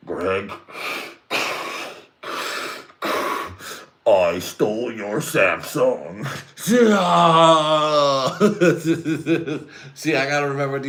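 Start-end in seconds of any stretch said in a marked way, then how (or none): 0.1-6.2 slow, drawn-out villain reveal; Darth Vader parody. Acted. Father reveals the theft to his son, slow Vader parody. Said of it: "it's just like Luke Skywalker finding out". 6.3-9.9 high, loud drawn-out cry; mock anguished wail. Son learns who stole it, hammed-up wail.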